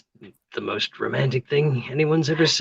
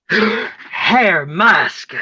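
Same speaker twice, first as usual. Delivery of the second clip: gasp, then blurt it higher and louder; shocked gasp; 8 semitones higher, 7 dB louder than usual. Acted. Gertrude blurts it, shocked by Theo's flattery. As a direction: recoil in scandalized surprise.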